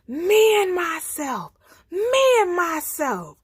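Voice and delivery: big loud high roaring chant; lion's roar. A narrator's reading. He lion roaring all the way to Man, loud bellow. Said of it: "and he roars".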